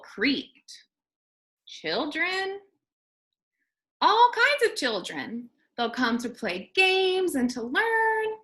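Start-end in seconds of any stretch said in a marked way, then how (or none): 1.7-8.4 higher janitor explaining; lightly voiced janitor. A narrator's reading. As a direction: cheerfully list the children. Janitor continues speaking.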